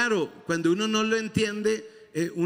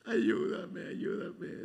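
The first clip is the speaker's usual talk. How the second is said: softer, half-whispered begging plea; imitated pleading prayer; 9 dB quieter than usual. Preacher imitating his old whispered plea to God. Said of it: "yo oraba así".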